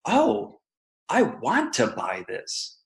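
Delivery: high "oh", then slow, settled decision; customer's sudden realization. Acted. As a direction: let it dawn on you, slowly. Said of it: "Rather, it's".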